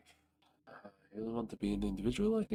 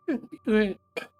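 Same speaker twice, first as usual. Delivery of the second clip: high squeaky laugh; 12 semitones higher, 9 dB louder than usual. Acted. Ant laughs.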